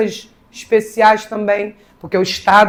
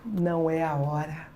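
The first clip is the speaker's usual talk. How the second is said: normal talking voice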